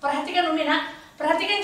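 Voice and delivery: ordinary talk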